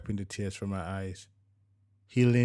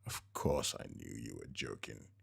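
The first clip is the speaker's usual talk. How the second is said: lower, softer sheepish husband; husband covering that he missed the joke; husband's voice; 9 semitones lower, 9 dB quieter than usual. A narrator's reading. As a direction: defend yourself weakly, a bit embarrassed. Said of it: "forcing a weak smile".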